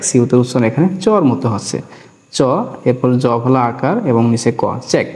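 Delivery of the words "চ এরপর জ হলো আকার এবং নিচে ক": ordinary talk, nothing marked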